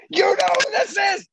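high, furious shout, words drawn out; acted full-force tirade; boss losing his mind. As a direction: bellow like an irate boss on the phone. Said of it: "And he lost his mind".

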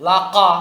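"লাকা" with a heavy, back-of-mouth "ক" sounding like "qaw"; mispronounced heavy kaf. Shown as a demonstration. Teacher showing learners a wrong letter, "লাকা" with heavy qaf. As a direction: make the second sound of "লাকা" a thick, loud qaf. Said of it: "অক্ষরের উচ্চারণগত ভুল হতে পারে".

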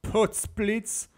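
hoher Potzblitz-Ruf; überraschter Ausruf. A narrator's reading. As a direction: ruf es verblüfft aus. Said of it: "ruft der Petrus". (Petrus ruft überrascht Potzblitz)

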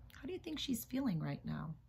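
plain reading voice

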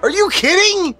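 high, disbelieving outburst; genuinely stunned; shocked. Real, not acted. Shocked at friend's story.

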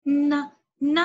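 "ن" as a loud, short "na", twice; nun with fatha. Shown as a demonstration. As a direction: punch out "ن" as two separate "na" syllables. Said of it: "حرف النون مع الفتحة كيف ننطقه؟". ("ن" مفتوحة بصوت عالٍ، مرتين)

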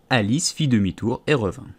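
plain reading voice

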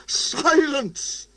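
high-pitched bellow without real volume. A narrator's reading. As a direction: bellow for order, held back in volume. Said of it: "he bellowed".

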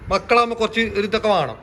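ordinary talk

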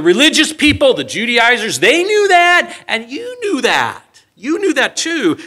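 high, loud preaching drive. Acted. Preacher driving the point to his congregation, full force. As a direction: press the point home to the crowd.

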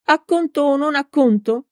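high, shrill little-priest voice; narrated shrill outburst; strident cry. A narrator's reading. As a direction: cry out indignantly, pitched well up. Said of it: "gridò con voce stridula".

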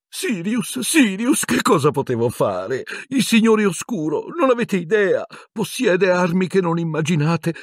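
high, wailing excuses; tearful Pettigrew. A narrator's reading. Pettigrew excusing his betrayal to Sirius, tearful squeal. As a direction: plead like a blubbering coward, very high. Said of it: "Pettigrew scoppiò a piangere".

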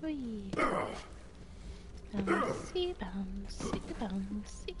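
playful whispered chant; breathy singsong whisper. Real, not acted. Playful chant while jumping.

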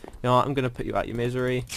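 usual speaking voice

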